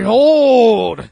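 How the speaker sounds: booming, high drawn-out shout; put-on proclaiming voice. Reviewer breaking into a comic bit, booming proclamation. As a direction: proclaim it like a grand reveal. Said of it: "now I did that voice".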